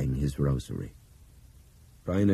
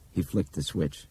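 plain reading voice

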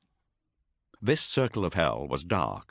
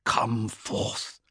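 hissed command; 5 semitones higher than usual. Acted. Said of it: "he hissed".

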